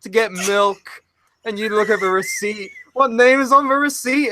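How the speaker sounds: high-pitched mock of the hosts; mock incredulous host. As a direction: ham up the baffled interviewer. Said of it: "they's like".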